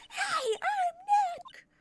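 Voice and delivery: shouting at the camera to set it off; loud shout. Shown as a demonstration. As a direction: belt it out so the mic catches it. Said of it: "not saying it loud enough".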